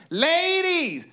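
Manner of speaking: high, drawn-out call to the crowd; high-pitched rallying cry; stage call-out. Acted. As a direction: hail the women like a singer on stage. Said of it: "She's like".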